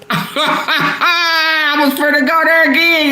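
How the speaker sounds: laughing through the words, slowed and high; giggling at herself mid-sentence. Real, not acted. Host laughing at her own near-slip on the livestream.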